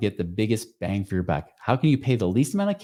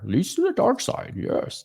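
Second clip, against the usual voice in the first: slow, badly faked Yoda voice; hammed-up Yoda croak, poorly imitated; Yoda impression; 12 semitones higher, slower than usual. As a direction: do a very bad Yoda, drawn out. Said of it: "very bad impression of Yoda".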